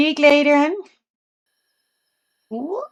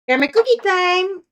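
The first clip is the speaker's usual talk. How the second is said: excited treat call; 8 semitones higher, 3 dB louder than usual. Real, not acted. Offering her dog a treat.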